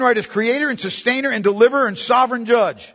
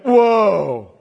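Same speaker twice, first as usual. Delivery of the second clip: shocked church reaction. Retold or imitated. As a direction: gasp out a shocked whoa. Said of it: "it would be like".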